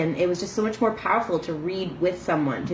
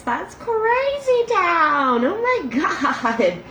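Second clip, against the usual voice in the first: excited; 10 semitones higher, 3 dB louder, much slower than usual. Real, not acted. Excited squeal at count.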